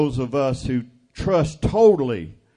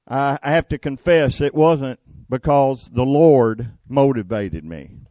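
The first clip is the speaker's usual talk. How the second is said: normal talking voice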